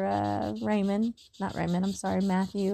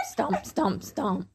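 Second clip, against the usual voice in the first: laughing stomp sounds; much slower than usual. Pretend. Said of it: "I do sound effects".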